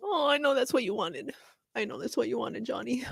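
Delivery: hushed and tender, close to a whisper; soft tender aside. Real, not acted. A moved viewer murmurs tenderly to Johnny: soft and hushed. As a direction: murmur it gently to him, moved.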